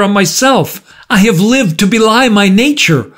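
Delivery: high, impassioned outpouring; cried out. A narrator's reading. Markheim defending his life to the visitor, crying out. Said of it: "cried Markham".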